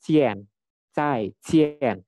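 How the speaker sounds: "Jian" with a C sound, falling from high; J read as C. Shown as a demonstration. Teacher shows how J is read, firm falling "Zaijian". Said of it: "seperti huruf C".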